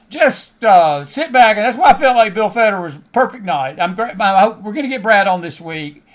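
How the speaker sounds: usual speaking voice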